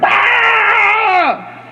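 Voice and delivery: long high yell of mock anger; hammed-up angry shriek; frustrated scream. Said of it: "I was getting angry".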